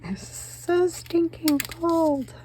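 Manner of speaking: high, slow, drawn-out complaint about the cold; high, slow mock shudder; mock shiver. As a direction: stretch it out high like you're freezing. Recalling icy lake water.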